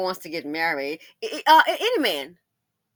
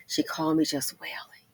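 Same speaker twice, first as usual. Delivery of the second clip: whisper. Real, not acted.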